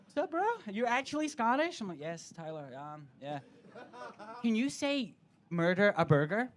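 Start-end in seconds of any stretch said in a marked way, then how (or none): 0.0-1.8 high-pitched mock American bro; caricatured American accent, raised pitch; Tyler. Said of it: "Tyler will come bouncing across the room". 2.0-3.4 low, soft, reluctant answer; deadpan retold resignation; weary reply. 4.4-6.5 mock American accent on "murder a burger"; eager American asking a party trick; Tyler. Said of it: "it's Americans asking me".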